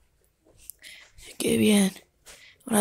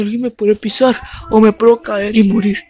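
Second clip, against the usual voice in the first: fearful; 7 dB louder than usual. Acted. Pet panics about size.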